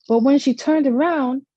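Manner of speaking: ordinary delivery, nothing marked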